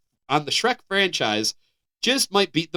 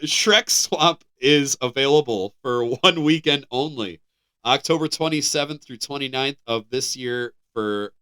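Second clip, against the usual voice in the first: ordinary talk, nothing marked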